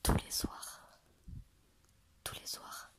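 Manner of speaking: soft whispering to a sleepy cat; ASMR whisper. Real, not acted. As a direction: hush it, barely a breath. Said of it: "Je fais de la A S M R".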